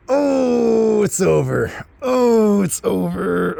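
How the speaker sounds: high, wailing "it's over"; mock despair. To himself as the girl unmasks, high mock wail. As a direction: whine it like all hope is lost.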